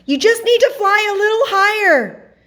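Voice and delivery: very high, loud urging; encouraging call. A narrator's reading. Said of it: "encouraged the moon".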